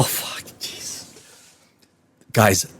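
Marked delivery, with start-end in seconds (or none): 0.0-1.1 under-the-breath swear; whispered curse. Real, not acted. Reviewer cursing at the film under his breath. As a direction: hiss it to yourself.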